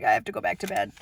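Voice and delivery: low, drooping, defeated mumble; mock weary moan. Tired mom recalling her crying morning, low defeated moan. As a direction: sag into it like you can't stay up. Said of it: "I'm like".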